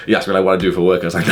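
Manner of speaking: ordinary talk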